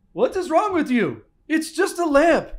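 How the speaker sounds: put-on light Scandinavian accent, high and loud. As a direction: scold the viewer in a hammed-up Scandinavian accent. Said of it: "Scandinavian accent".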